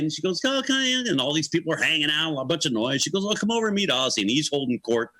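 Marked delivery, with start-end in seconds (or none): 0.4-1.1 nice, polite hostess, pitched high. Retold or imitated. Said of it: "She's all nice and polite". 3.3-4.2 lightly imitated polite hostess, higher voice. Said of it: "She's all nice and polite".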